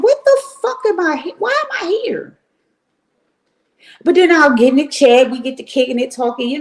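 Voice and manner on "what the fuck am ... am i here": higher pitched, incredulous self-questioning; high disbelieving mutter to yourself; exasperated disbelief. Retold or imitated. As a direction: ask yourself why you're even here, baffled. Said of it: "And I be like".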